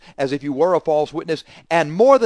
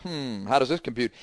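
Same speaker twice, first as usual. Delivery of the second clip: ordinary delivery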